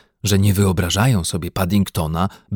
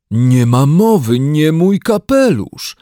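distraught bear; 7 semitones higher, 7 dB louder, slower than usual. Acted. Bear refuses hat loss.

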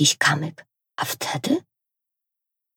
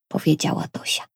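ordinary delivery

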